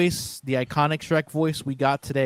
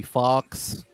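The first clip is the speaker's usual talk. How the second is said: British accent; 2 semitones lower than usual. Shown as a demonstration. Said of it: "we'd actually butcher your name".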